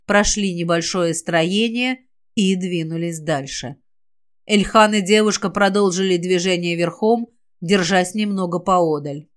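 ordinary delivery, nothing marked

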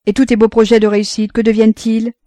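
narrator's dismayed, taken-aback father; stupefied. A narrator's reading. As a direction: ask it loudly in disbelief. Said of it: "Roland demeurait stupéfait".

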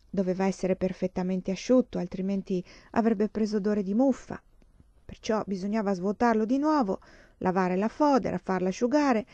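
usual speaking voice

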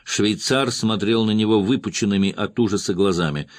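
plain reading voice

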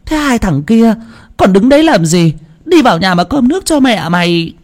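a grandmother calming her daughter-in-law; old grandma pleading. A narrator's reading. Grandma pleading then scolding.

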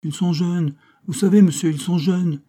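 pleading. Acted. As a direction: beg indulgence for the young clergy. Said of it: "dit-il d'un ton suppliant".

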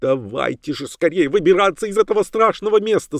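high-pitched urgent plea; high, frightened woman's voice; panicky cry. A narrator's reading. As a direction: urge everyone to flee. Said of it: "закричала миссис Роджерс".

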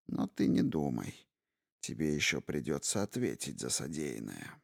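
low, soft menacing warning; ominous voice. Acted. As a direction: threaten quietly and darkly. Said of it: "Зловещим голосом предупредила".

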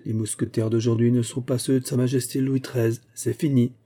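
usual speaking voice

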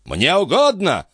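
high-pitched outraged retort; old baron's irate cry; indignant exclamation. A narrator's reading. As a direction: throw it back in high-voiced indignation. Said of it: "воскликнул бледный от негодования барон".

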